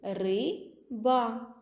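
ри-ба split into syllables; syllabified. Shown as a demonstration. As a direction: say «ри-ба» as two separate syllables, ри then ба. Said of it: "буде два склади". (вимовте «ри-ба» по складах)

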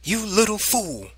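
high, sharp mother's rebuke; angry scolding. A narrator's reading. Mother scolds child over kitten.

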